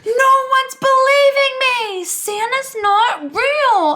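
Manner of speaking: put-on high, loud whiny child voice, slowing at the end; child insisting Santa's not real, high and loud; whiny kid. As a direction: imitate an upset first grader. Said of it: "he's like".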